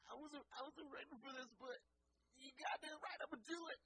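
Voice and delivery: high, cracking voice, building to a shout; excited shaky quote. Retold or imitated. Guest overwhelmed by the offer, high cracking shout. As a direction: squeal it out, overwhelmed, then yell yes. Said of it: "he was like".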